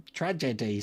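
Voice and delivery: "Tragedy" in careful syllables, tra-je-dy; taught pronunciation. Shown as a demonstration. Proving the elocution lesson worked, "Tragedy" carefully pronounced. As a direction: repeat "Tragedy" neatly sounded out. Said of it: "Phil taught me to say tragedy".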